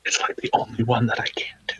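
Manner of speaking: quick whispered aside to himself. Real, not acted. Player whispering an aside while checking spells, hushed. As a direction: whisper it under your breath, fast.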